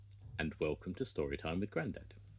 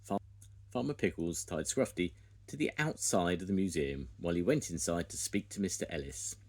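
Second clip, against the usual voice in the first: ordinary delivery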